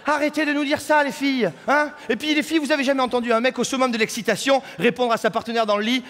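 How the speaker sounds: ordinary talk, nothing marked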